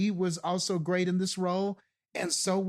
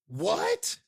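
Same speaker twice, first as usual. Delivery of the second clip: high-pitched, stunned questioning; incredulous disbelief; 6 semitones higher than usual. Acted. Host reacting to an actor's debut, incredulous. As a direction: react in disbelief, pausing between bursts.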